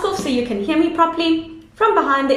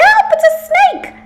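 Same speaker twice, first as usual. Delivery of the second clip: high, loud yell of fright; frightened yell; 5 dB louder than usual. A narrator's reading. Leopard's child fleeing in fright, yelling loud. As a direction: shriek it as you run off scared.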